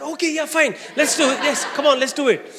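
high, loud, laughing eager agreement; excited yes. Retold or imitated. Sister gladly agreeing to the wedding venue, excited and laughing. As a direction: burst out with delighted consent.

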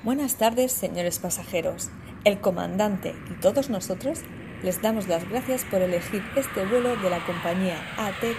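soft cabin crew announcement; airport announcement. Pretend. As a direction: welcome passengers on board. Mock cabin crew welcome. (anuncio de azafata)

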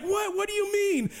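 very high, amazed questioning; astonished. Retold or imitated. New convert hears heaven is having a party, astonished. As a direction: pitch it right up, eager to understand.

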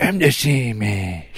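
slow, drawn-out goofy comedian voice; Adam Sandler. Retold or imitated. Podcaster echoing the co-host's Adam Sandler, slow mimicking voice. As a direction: drag out the catchphrase in a put-on voice. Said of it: "give me your best Adam Sandler impression".

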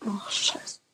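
whispered curse under breath; whispered disappointment; frustrated whisper. Real, not acted. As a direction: hiss it in quiet annoyance. Losing a guess in the game.

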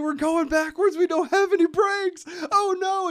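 high, loud hammed-up panic; mock screaming panic. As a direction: scream it as a fake emergency. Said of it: "she starts screaming".

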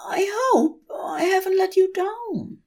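high, anxious angel voice; anxious Aziraphale. A narrator's reading. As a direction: ask it with worry creeping in.

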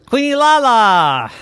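high, loud, drawn-out whoop; silly nonsense. Acted. Yvette saying silly things to herself, giddy nonsense. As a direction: stretch it out, sliding down from a high pitch. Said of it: "we could say silly things".

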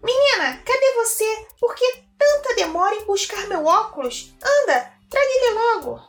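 high, loud, impatient shout; impatient witch. Acted. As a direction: yell for the girl to hurry up. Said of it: "disse a bruxa impaciente". (bruxa impaciente gritando)

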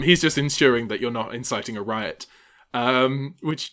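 usual speaking voice

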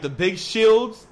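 normal talking voice

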